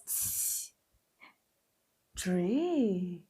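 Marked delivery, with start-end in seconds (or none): none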